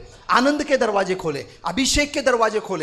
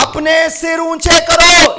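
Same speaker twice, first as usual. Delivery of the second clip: louder and slower, each word drawn out; loud slow proclamation; 4 semitones higher, 8 dB louder, slower than usual. Shown as a demonstration. Preacher shows the congregation how to proclaim it, loud and slow. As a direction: command the gates to lift their heads. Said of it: "इधर देखो, इधर देखो".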